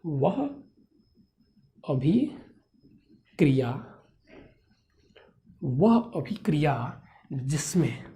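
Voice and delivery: word by word, long pauses and stretched out; slow dictation. Shown as a demonstration. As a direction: dictate it very slowly for note-takers. Dictating for students to write. (धीरे-धीरे, रुक-रुककर लिखवाते हुए)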